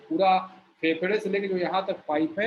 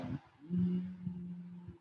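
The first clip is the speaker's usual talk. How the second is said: slow, soft humming imitating a bee; a soft drawn-out bee buzz; bumblebee hum; 12 dB quieter, much slower than usual. Shown as a demonstration. Teacher imitates an approaching bumblebee, soft slow hum. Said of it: "मधुमक्खी आपने भौरा देखा हो".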